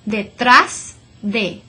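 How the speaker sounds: "detrás" slow, last syllable trás punched; stress on trás. Shown as a demonstration. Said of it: "stress that last syllable".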